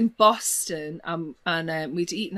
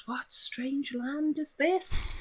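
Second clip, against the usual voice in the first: bemused wonder; 8 semitones higher, 5 dB quieter, much slower than usual. Retold or imitated. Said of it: "And I just thought".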